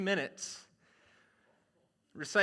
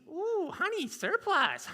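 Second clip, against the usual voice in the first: high Midwest coo over surplus; delighted Midwest wife; 12 semitones higher, slower than usual. Acted. Wife delighted by the surplus hut, high Midwest coo. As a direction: gush like a Minnesota shopper. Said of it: "has the most Midwest accent".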